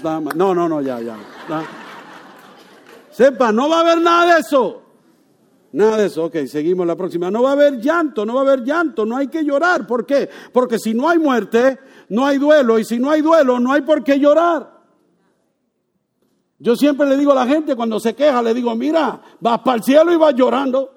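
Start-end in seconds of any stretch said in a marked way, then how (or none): 3.1-6.2 much higher, louder on nada; emphatic preaching. Real, not acted. Pastor stressing promise to congregation. 7.3-20.9 higher, louder preaching cadence; full-force preaching. Real, not acted.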